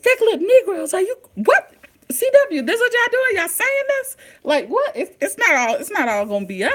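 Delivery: high, loud outraged disbelief at the TV; shocked disbelief. Retold or imitated. Vlogger reacting to the show's insult, high incredulous outrage. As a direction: squeal it like you nearly fell out of your chair. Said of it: "I said".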